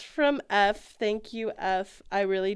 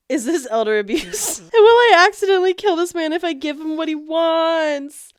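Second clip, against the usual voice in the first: laughing; 7 semitones higher, 8 dB louder than usual. Retold or imitated. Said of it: "I was like".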